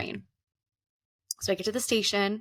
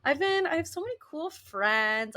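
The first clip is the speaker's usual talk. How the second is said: gushing; 6 semitones higher than usual. Retold or imitated. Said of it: "I was like".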